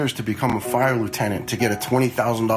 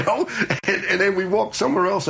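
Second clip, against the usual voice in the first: laughing through the words, pitched up; 9 semitones higher than usual. Real, not acted. Telling an interviewer about the museum trip, laughing. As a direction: chuckle as you carry on the story.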